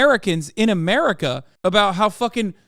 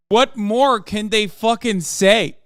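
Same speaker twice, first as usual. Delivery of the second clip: ordinary delivery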